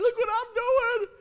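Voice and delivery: very high, loud, drawn-out shout; mock yelling. Overworked church member to everybody, high stretched attention-seeking yell. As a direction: scream it for attention. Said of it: "You're too busy yelling at everybody".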